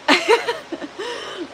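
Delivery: a short, high burst of laughter; amused laugh. Real, not acted. Streamer laughing at her race car joke, amused laugh.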